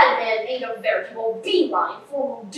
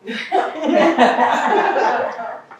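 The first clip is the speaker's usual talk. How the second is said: triumphant laugh. Acted.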